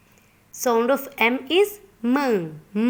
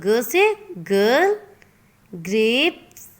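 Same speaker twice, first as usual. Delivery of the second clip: usual speaking voice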